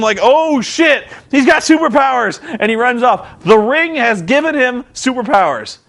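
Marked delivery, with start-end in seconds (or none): 0.2-2.4 high, mock-startled bad-guy gasp; alarmed. Villains seeing Abyss powered up, mock alarm. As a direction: recoil at a hero's new power. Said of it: "they see this and".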